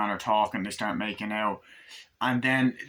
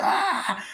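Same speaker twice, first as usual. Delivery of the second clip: very high imitated scream; mock scream; 12 semitones higher than usual. She runs from Chucky, high mock scream. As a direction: let out a high retold shriek.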